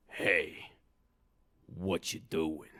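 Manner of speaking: low, slow goblin drawl. Acted. New goblin surprising Herschel at dreidel, low slow drawl. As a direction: draw it out low as a nosy goblin. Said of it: "and then he heard a voice".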